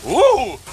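loud, high "ooh"; startled whoop. Real, not acted. Reacts on checking the food.

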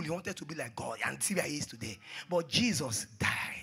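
usual speaking voice

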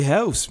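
"house" with an odd vowel; weirdly pronounced house. Real, not acted. Player slipping on a word, odd "house". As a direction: say "house" slightly off. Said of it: "I said house really weird".

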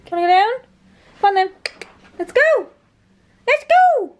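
high and loud, rising eagerness; high eager dog talk. Real, not acted. Urging the dog to come.

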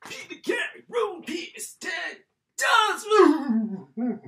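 high, loud outraged yell; outraged shout. Real, not acted. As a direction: yell the price in disbelief. Outraged at the game price.

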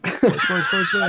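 high, laughing sing-song call; laughing echo. Real, not acted. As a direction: echo the greeting through a laugh. Echoing the greeting, laughing.

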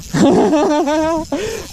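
a high giggle bursting out of excitement; excited high laugh. Real, not acted. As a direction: laugh in delight as the fish pulls. Laughing while fighting a fish.

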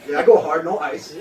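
ordinary talk

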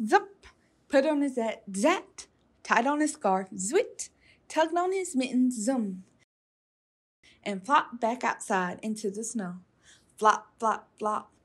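ordinary delivery, nothing marked

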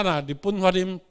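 usual speaking voice

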